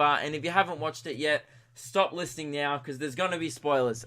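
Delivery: ordinary delivery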